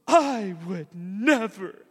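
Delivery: high, loud, slow, crying protest of love; big sister sobbing to her baby sister, hammed-up; tearful big sister. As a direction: plead through tears like a heartbroken big sister. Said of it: "looks at her baby sister and goes".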